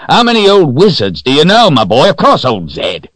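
put-on high, loud, hearty old-timer voice; jolly old man. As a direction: play a jovial old fellow reassuring startled travellers. Voices jolly Old John, amused.

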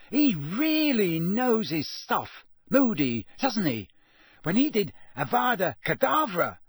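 high, excited schoolboy, stretching words; Ron. A narrator's reading. Boy relives lesson on way to dinner, awestruck.

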